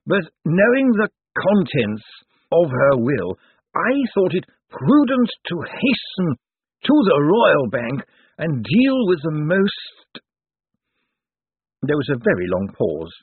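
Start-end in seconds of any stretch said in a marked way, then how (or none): 0.1-10.2 higher, slow, dusty legal voice; dry old zombie lawyer. Acted. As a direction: ancient lawyer weighing every word. Slant explains his haste.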